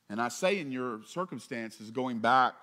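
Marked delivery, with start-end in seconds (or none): none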